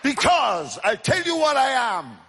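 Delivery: loud sermon building to a high shout; full-force preaching. Real, not acted. Evangelist telling how he answered the atheist, full-force preaching.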